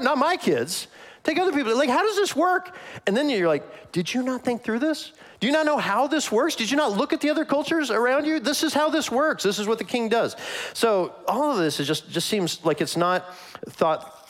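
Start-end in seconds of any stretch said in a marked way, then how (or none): 0.0-2.8 very high, panicky pleading; squeaky, hammed-up dismay; mock alarmed protest. Acting out alarmed parent's protest. 3.9-10.4 high-pitched, exasperated rhetorical questions; hammed-up exasperation, pitched way up; incredulous scolding. Acted incredulous scolding of the people.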